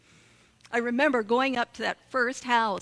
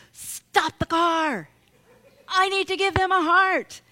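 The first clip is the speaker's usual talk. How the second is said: put-on higher, louder kid voice calling out from the back seat; child's yell; 6 semitones higher, 5 dB louder than usual. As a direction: yell it like an eager boy demanding a stop. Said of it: "he yells out".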